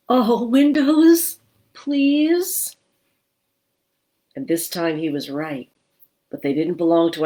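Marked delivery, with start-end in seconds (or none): 0.1-2.8 high, slow, pleading; hopeful ghost voice. Acted. Gilbert spots lit windows and hopes for a home, high pleading. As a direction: beg it with bright hope.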